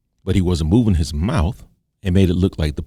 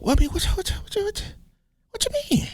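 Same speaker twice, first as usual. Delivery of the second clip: stammering squeak, climbing ever higher; voice going up; 5 dB quieter, faster than usual. Shown as a demonstration. Hurt grandson questions granddaddy, voice going up. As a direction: stutter softly as the pitch shoots up. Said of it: "then your voice go up".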